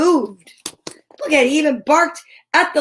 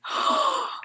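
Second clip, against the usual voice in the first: sharp gasp; 7 dB quieter than usual. Acted. Cat escapes into yard.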